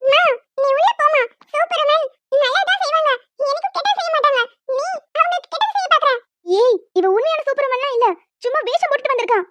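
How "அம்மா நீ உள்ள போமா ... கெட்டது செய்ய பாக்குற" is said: put-on high, indignant kid's voice; scolding child. Little girl scolding her mother, indignant. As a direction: tell mum off like a small girl.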